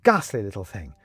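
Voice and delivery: lightly voiced Benedict, uneasy; Benedict, anxious recollection. A narrator's reading. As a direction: rush through it as it slips away.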